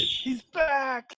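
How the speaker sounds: high, thrilled exclamation; excited cry. Acted. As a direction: burst out in relief. Player reacts to Ditch returning.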